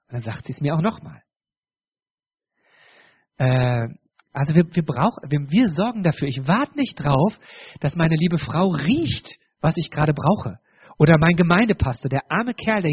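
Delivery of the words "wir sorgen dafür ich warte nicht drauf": ordinary talk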